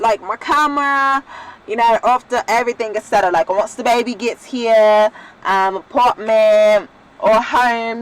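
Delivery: playful put-on Australian accent. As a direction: slip into an Australian accent, excited. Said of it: "do think that I'm from Australia. Like, I've been doing that accent".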